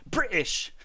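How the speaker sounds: mock British accent, T dropped. Shown as a demonstration. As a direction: put on a clumsy British accent.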